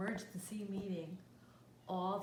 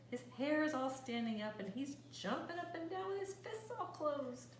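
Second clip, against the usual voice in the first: usual speaking voice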